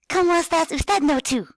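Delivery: put-on high eager little kid voice. Shown as a demonstration. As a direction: squeak it like an excited child. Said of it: "talk very enthusiastically with a kid voice".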